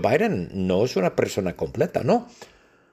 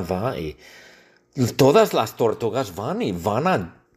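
normal talking voice

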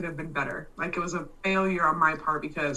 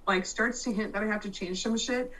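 ordinary talk, nothing marked

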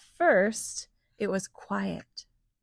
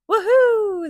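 higher, louder gleeful whoop; excited whoop; 12 semitones higher, 9 dB louder than usual. Acted. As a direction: cheer it out, too excited to sleep. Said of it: "they laughed".

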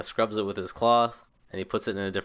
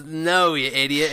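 put-on loud and higher drunk shout; 5 semitones higher, 5 dB louder than usual. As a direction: yell it across the tavern. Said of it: "shouting into the tavern".

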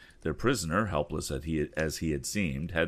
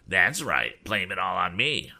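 whining; 3 dB louder than usual. Acted. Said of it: "whined the squint-eyed bully".

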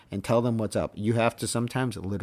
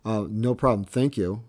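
mock-polite, raised and louder thanks; sarcastic; 4 semitones higher, 5 dB louder than usual. Assistant coach brushed off by the umpire, sarcastic. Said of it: "very sarcastically".

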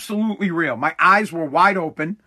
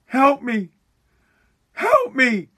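higher, strained cry at no great volume; mock yell for help; 4 semitones higher, slower than usual. Telling listeners his nightmare, mock yelling for help. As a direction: plead higher each time, like yelling in a nightmare. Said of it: "I'm, like, yelling".

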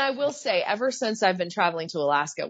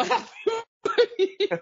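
quoting dad while breaking into laughter; laughing through quote. Retold or imitated. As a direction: laugh out the last words. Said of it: "He's like".

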